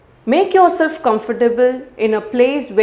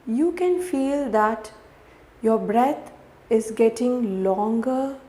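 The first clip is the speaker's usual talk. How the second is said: softer, unhurried meditation voice with long pauses; guided meditation; 12 dB quieter than usual. Real, not acted. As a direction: guide the listener gently through each breath.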